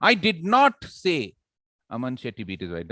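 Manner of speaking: categorical denial, punching "not"; stress on NOT. Shown as a demonstration. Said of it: "I categorically deny the fact".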